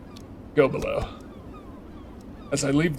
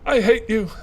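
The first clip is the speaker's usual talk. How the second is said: high, louder cry of hatred; hateful outburst; 6 semitones higher, 3 dB louder than usual. Acted. Clarissa shouting her hatred at Veronica through the door. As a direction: throw the words at her.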